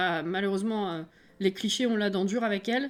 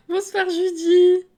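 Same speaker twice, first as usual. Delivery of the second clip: high, drawn-out mock-sweet hello; sing-song greeting to a viewer; playful greeting; 9 semitones higher, 11 dB louder, much slower than usual. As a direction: stretch the greeting in a high cartoonish voice.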